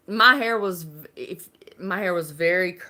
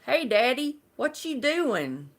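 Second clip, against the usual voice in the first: Southern greeting; 7 semitones higher than usual. Retold or imitated. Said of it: "be like".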